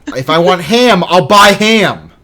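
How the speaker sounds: hammed-up outrage, drag out I'll buy ham; mock rant. Host ranting at a co-host over Canadian bacon, hammed-up outrage.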